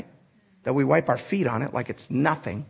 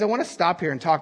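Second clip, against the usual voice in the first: ordinary talk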